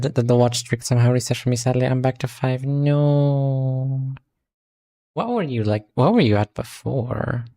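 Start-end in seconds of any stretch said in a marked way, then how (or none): none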